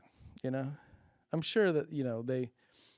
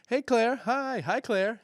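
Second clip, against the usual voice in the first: chirpy greeting; 8 semitones higher, slower than usual. Retold or imitated. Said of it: "she's like".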